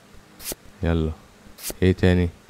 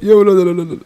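high, strained cry cut off mid-curse; exasperated squeal; 11 semitones higher than usual. Real, not acted. Frustrated cursing at the game. (صرخة رفيعة من الغيظ)

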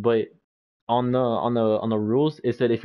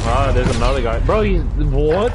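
surprised; 3 semitones higher, 8 dB louder than usual. Real, not acted. Surprised by enemy mid-fight.

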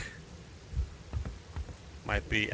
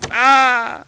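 loud high jump-scare scream; sudden frightened yelp; startled scream; 12 semitones higher, 10 dB louder than usual. Real, not acted. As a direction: shriek in fright.

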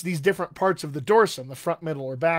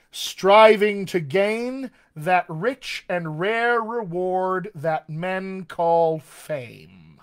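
very slow, every word drawn out; a teacher reading out a drill very slowly; slow recitation; 5 dB louder, much slower than usual. Shown as a demonstration. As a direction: model it slowly for the class. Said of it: "Just repeat after me".

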